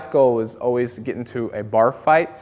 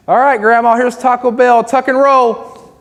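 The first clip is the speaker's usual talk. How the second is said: mock shout; 9 semitones higher, 8 dB louder than usual. Mock announcer to grandma.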